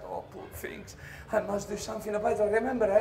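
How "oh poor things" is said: breathy whisper of pity; soft whispered sympathy. Retold or imitated. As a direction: hush it in sorrow. Seeing the gutted magician.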